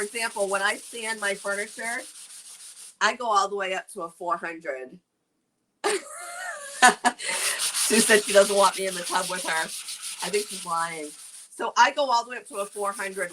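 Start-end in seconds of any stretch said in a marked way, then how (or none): 5.8-7.1 a big burst of laughter; loud laugh. Real, not acted. Reacting to a viewer comment.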